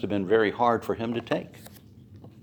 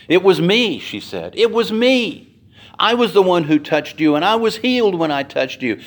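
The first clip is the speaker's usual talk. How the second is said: higher, louder urgent confession; woman at Jesus' feet pouring it out; woman confessing; 7 semitones higher, 4 dB louder than usual. Acted. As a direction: blurt it out, desperate.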